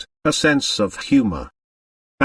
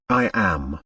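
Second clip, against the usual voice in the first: detached tone; 5 semitones lower, 4 dB quieter than usual. A narrator's reading. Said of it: "She spoke in a detached, impersonal tone".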